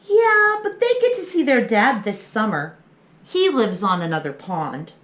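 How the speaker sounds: high, eager burst; excited little pup. Acted. Little pup excitedly telling his parents about Ben. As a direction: jump in with a bright yes.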